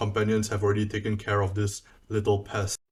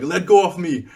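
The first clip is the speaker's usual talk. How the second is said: high, loud cry of a struggling captive; acted screaming protest; 8 semitones higher, 8 dB louder than usual. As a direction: call out as if grabbed and fighting free. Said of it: "he's kind of, like, screaming and cursing".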